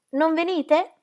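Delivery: high-pitched coaxing invitation; forced cheer. A narrator's reading. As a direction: invite with effortful cordiality. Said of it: "assumere un'espressione di gaia cordialità".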